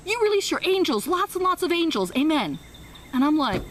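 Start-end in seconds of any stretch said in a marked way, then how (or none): 0.1-2.6 much higher, louder, lofty close; high soaring amen; grand fluent prayer. Retold or imitated. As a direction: finish the prayer in triumph. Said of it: "she ended it with".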